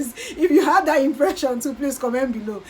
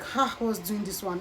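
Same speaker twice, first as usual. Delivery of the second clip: soft, low aside; imitated inner thought; 5 semitones lower, 10 dB quieter than usual. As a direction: drop it low, as if thinking to yourself. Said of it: "in her mind".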